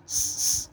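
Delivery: س hissed sharply through closed teeth; clenched-teeth hiss. Shown as a demonstration. Teacher isolating the sound, س as a sharp hiss. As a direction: set the teeth together and hiss س. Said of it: "دونوں دانتوں کو جما کر".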